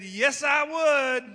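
shouted slow affirmation; emphatic yes. Retold or imitated. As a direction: declare it proudly. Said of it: "And I said".

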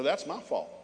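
ordinary talk, nothing marked

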